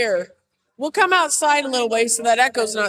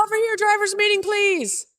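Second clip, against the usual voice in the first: raised voice to gather a crowd; calling out; 6 semitones higher, 4 dB louder than usual. Real, not acted. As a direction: call the drivers over loudly. Summoning drivers across the lot.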